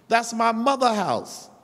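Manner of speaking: "mother" said with no 's after it; no possessive s. Shown as a demonstration. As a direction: leave the 's off "mother". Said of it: "We don't have to have a apostrophe S".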